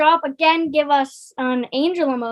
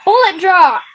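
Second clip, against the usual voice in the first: announcer reveal; 3 semitones higher, 7 dB louder than usual. Acted. Announcing the wheel result.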